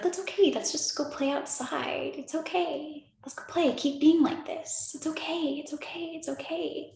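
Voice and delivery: high, soft soothing voice; comforting a child. Acted. Adult self comforting little self, high and tender. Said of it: "adult you holding little you and, and being like".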